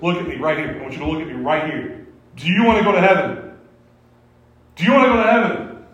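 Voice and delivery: building from level to high, loud and fast; pushy evangelist. Retold or imitated. Director of evangelism pressing a waiter, pushy and rising. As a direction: press the waiter harder each time. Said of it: "he goes".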